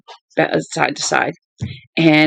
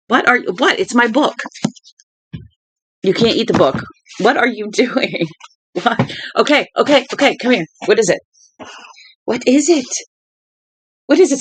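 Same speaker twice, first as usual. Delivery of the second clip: talking to the dog; 6 semitones higher than usual. Real, not acted.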